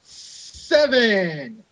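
high, hammed-up counting; high-pitched parody of a ten count; mock referee count. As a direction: call the count like a referee racing through it. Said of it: "you wanna talk about fast counts".

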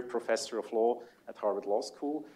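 ordinary talk, nothing marked

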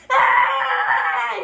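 loud high scream of fright. Acted. Boy seeing a giant stare over him, loud high scream. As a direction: shriek before fainting. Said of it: "he said".

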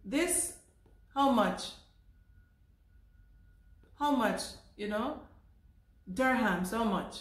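short clipped phrases, words dropped; mock broken English with pauses; chopped broken English. Shown as a demonstration. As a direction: chop the question into bits. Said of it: "I have to say".

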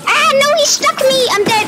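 high-pitched yelp of dismay; alarmed in-game wail; high dismayed cry. Real, not acted. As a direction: cry out high as you're taken out. Player stuck and killed in game.

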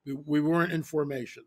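normal talking voice

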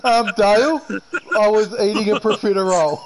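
high, loud laughing excuse. Real, not acted.

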